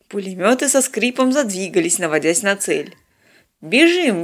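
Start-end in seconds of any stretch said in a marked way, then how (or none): 3.6-4.2 raised, urgent command; barked order. A narrator's reading. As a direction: bark it at the crew. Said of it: "гаркнул Станислав". (гаркнуть команду)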